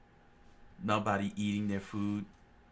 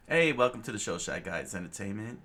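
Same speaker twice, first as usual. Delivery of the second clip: ordinary delivery, nothing marked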